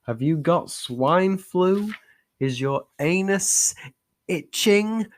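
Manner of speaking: slow, high mock-tabloid reading; dramatic headline. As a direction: ham up the lurid headline.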